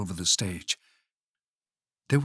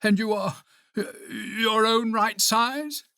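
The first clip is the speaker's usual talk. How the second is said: high, slow, wondering question; high-pitched hesitant disbelief; hesitant asking; 10 semitones higher than usual. A narrator's reading. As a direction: check warily, drawing the words out. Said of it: "asked Toad".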